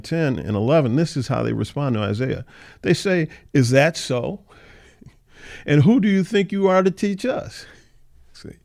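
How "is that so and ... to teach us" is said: mocking indignation. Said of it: "they're indignant".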